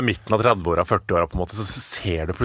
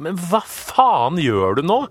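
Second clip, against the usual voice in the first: irritated retold snap; 7 semitones higher, slower than usual. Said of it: "Han bare".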